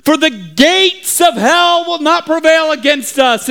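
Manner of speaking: high, grand mock proclamation; brave Bible student. Bible student rallies the panicking town, brave proclamation. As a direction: proclaim it heroically, drawing out the warnings. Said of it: "one brave s-, Bible student stands up and says".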